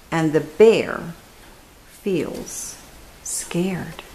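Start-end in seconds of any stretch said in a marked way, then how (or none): 1.9-4.0 breathy whisper, dropping away; whispered refrain. A narrator's reading. Reading a bedtime story, whispering that bear feels scared. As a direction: hush it like a secret at bedtime.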